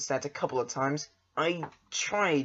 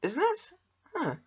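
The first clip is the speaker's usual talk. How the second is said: surprised, much higher "Isn't it?"; 13 semitones higher, 4 dB quieter than usual. Real, not acted. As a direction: react with real surprise. Surprised at non-native speaker.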